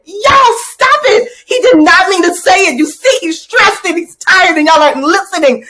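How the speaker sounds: put-on high, loud little-girl voice scolding the class. Little girl defends stressed teacher to class, high scolding voice. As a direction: play the bossy little girl sticking up for the teacher. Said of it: "the little girl looked at me and said".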